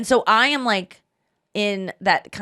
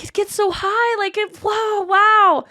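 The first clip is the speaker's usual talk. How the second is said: high, wondering wow; mock awe; 12 semitones higher, 3 dB louder, slower than usual. Crowd watching a balloon float away, awed. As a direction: gaze up in amazement.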